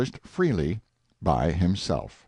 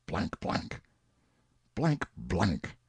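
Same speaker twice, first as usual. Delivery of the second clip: muttered oaths; 2 semitones lower, 7 dB quieter, slower than usual. A narrator's reading. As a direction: mutter censored curses, very softly. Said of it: "lets off brisk volleys of unsanctified language".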